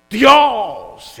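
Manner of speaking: very loud, high, long-held cry to God; pompous temple-shaking invocation; booming Pharisee prayer. Acted. Pharisee praying so the temple curtains shake, booming. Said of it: "se estremecían hasta las cortinas del templo".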